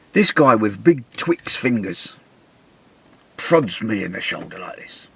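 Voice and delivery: ordinary delivery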